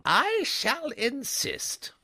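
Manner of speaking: slow, insistent lady's voice; firm headmistress. A narrator's reading. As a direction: put your foot down like a headmistress. Miss Tomlinson's firm reply.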